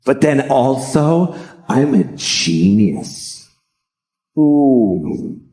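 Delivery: slow, drawn-out mock marveling; hammed-up self-admiration; self-amazed Matt Damon. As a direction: savor your own genius, very slowly. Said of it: "So he basically sat down. He's like".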